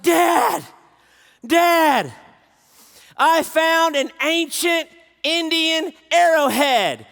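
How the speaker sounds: put-on high, loud excited girl shouting; teen daughter shouting her find to dad. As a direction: burst in thrilled, voicing a daughter. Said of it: "She's so excited".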